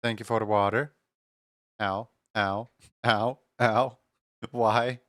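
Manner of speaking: ordinary talk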